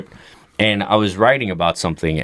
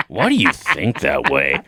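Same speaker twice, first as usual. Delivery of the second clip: slow low dad voice; 8 semitones lower than usual. Retold or imitated. Son voices dad slowly.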